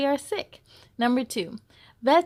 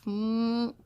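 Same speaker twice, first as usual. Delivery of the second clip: usual speaking voice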